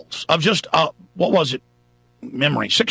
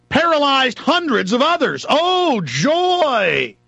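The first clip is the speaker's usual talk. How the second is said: high mock cheer on joy; sarcastic; 10 semitones higher, slower than usual. Real, not acted. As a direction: sneer it with fake delight. Sarcastic about vaccine harm.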